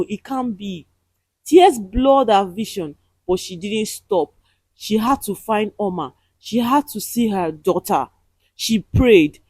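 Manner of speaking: plain reading voice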